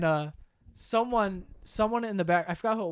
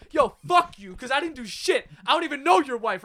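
raised, higher angry protest; retold angry screaming; 5 semitones higher than usual. Student yelling back at the accusing teacher, mock screaming. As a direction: reenact yelling back, indignant. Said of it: "So I'm screaming at him".

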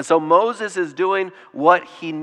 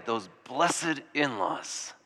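sarcastic; 3 semitones lower, 10 dB quieter, much slower than usual. Retold or imitated. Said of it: "These people probably would say".

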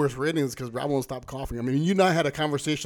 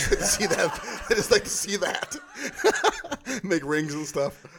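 laughing; 5 semitones higher, 3 dB louder, slower than usual. Real, not acted. Laughing about smokers blowing breath.

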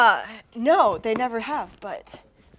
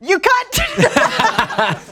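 much higher mock taunt, breaking into laughter; laughing insult; 14 semitones higher than usual. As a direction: hurl it while cracking up. Mock duel insult between rivals.

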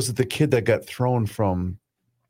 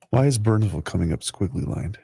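usual speaking voice

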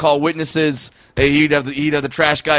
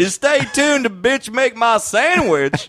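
Doctor Phil impression; 11 semitones higher, slower than usual.

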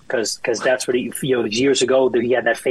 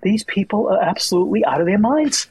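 incredulous at the fans; exasperated; 8 semitones higher than usual. Retold or imitated. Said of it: "I'm like".

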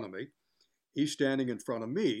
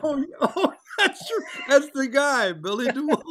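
loud, high laughter through words; laughing hard at the memory; 12 semitones higher, 7 dB louder than usual. Real, not acted. Guest laughing with host about DeWolf.

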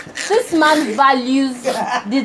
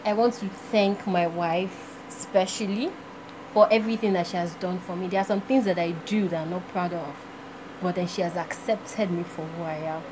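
quiet quoted speech; 9 semitones lower, 11 dB quieter than usual. Retold or imitated. Quoting husband's award speech.

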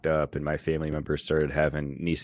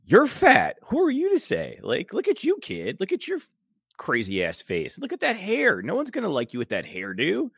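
squeaky mocking voice, much higher, taunting a kid; mocking retort; 12 semitones higher than usual. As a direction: sneer at the kid in a high squeal. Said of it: "My immediate retort back would have been".

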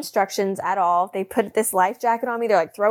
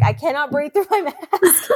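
panicked, laughing; 6 semitones higher than usual. Retold or imitated. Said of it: "I'm like".

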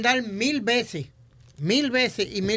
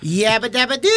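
Fred Flintstone catchphrase; 4 dB louder than usual. Retold or imitated. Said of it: "él lo decía más hombrecito".

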